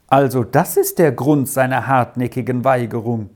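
plain reading voice

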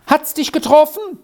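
high, alarmed cry; startled shout. A narrator's reading. Squirrel fears the tub hit Arthur, startled shout. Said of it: "schrie Squirrel erschrocken herunter".

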